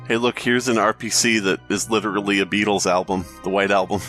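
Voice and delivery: normal talking voice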